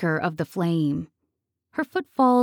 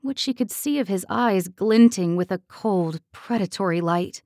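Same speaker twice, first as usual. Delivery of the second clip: ordinary delivery, nothing marked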